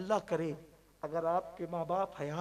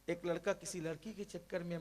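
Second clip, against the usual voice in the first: ordinary talk, nothing marked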